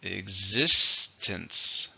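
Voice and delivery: drawn-out demo of Existence; careful slow repeat for learners; slow clear model. Shown as a demonstration. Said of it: "Stresses on the second".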